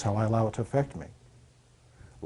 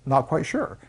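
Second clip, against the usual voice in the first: drawn-out hesitant hum, higher pitch; unsure; 4 semitones higher, much slower than usual. Acted. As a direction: imitate someone stalling, unsure of the answer. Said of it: "Some people say".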